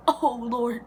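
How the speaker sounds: loud, high anguished oh Lord; horrified. Retold or imitated. Seeing her hair in the mirror, a loud anguished cry. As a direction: cry out like you might faint. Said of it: "I'm about to pass out".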